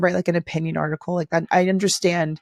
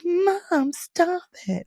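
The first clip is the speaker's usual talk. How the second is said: high-pitched, mock-embarrassed kid; kid's embarrassed plea; 11 semitones higher, 4 dB quieter, much slower than usual. Mimicking her son begging mom to stop, high-pitched. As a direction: whine it like an embarrassed child. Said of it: "It's like".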